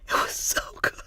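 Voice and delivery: hushed, voiceless rave about food; awed whisper. Retold or imitated. Telling a friend about the food she tried, awed whisper. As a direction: whisper it, savouring.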